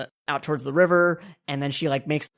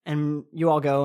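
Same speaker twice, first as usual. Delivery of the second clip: usual speaking voice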